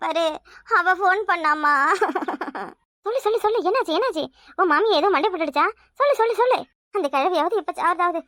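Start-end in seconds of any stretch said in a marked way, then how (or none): 1.8-2.7 short low laugh; low chuckle. Acted. Mother laughing at the gossip, low chuckle. 3.0-4.3 eager coaxing. Acted. Mother pressing for news. 6.0-6.6 "சொல்லு, சொல்லு, சொல்லு" pushed higher and faster; urgent insisting. Acted. Mother impatiently demanding the news, higher and urgent.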